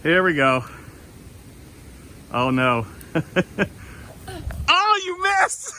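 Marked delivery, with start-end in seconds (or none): 4.7-5.8 high, slow, teasing "you missed"; real delighted high-pitched teasing; gleeful taunt. Real, not acted. Daughter throws snowball, misses.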